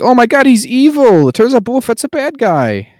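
high, loud hammed-up gasp of discovery; mock shock. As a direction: squeal the big reveal, hamming it up.

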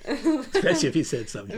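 bursting into laughter mid-sentence; laughing. Real, not acted. Son laughing, remembering Dad's scratchy beard rubs, laughing.

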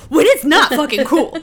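loud, high burst with laughter; mock outrage. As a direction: fire back indignantly, half laughing. Indignant retort to retold soothing.

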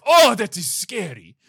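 loud, high cry of fear; hammed-up scared yelp; mock fright. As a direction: play terrified for laughs. Cat scared by lion, acted.

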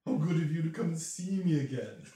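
lightly voiced dragoness, pleased; dragon speaking. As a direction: greet him like an amused old dragon. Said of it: "and says".

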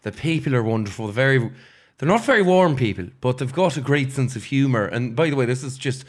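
lilting talk as an Irishwoman in an Irish accent. Shown as a demonstration. Performer showing friends an Irishwoman on request, Irish accent. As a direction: praise the country like an Irish local, in Irish accent. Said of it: "We wanna hear the Irish accent".

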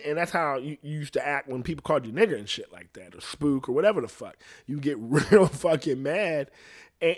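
ordinary talk

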